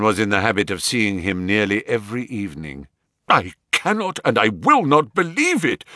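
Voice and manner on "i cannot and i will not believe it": high, raised refusal; cried out. Acted. Banker crying out his refusal to believe. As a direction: cry out in denial. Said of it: "cried the banker".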